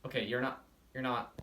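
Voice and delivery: ordinary delivery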